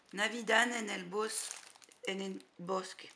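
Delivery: Spanish title read with a Spanish accent, halting on Bosque. Shown as a demonstration. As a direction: try the Spanish name in a Spanish accent. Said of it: "J'ai un accent, euh, espagnol".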